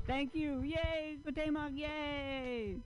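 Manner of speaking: high-pitched cheering emcee voice; comedy host announcer. Real, not acted. Host bringing the next comedian to the stage, cheering announcer. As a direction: whip up applause for the next act.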